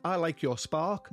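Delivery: ordinary delivery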